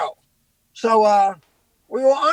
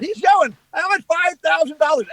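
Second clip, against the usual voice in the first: gleeful retold boast, pitched up; excited announcing; 5 semitones higher than usual. Comic telling the crowd about his fines, excited announcing.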